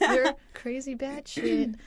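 laughing agreement. Real, not acted.

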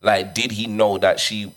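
ordinary talk, nothing marked

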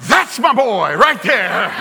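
loud, drawn-out proud-dad cheer; booming mock-triumphant father; proud exclamation. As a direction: crow it slowly through laughter. Proud father reacting to son's verdict.

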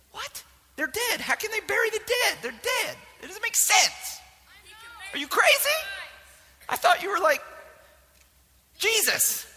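high, incredulous what-are-you-saying; baffled outrage. Retold or imitated. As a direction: squeak out total disbelief. Confused listener mocking Jesus.

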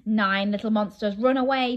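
plain reading voice

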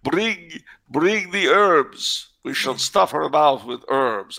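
put-on ceremonious decree in a dubious Amazonian accent; mock Amazonian accent. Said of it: "if that was an Amazonian accent".

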